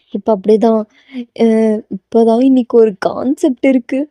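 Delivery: plain reading voice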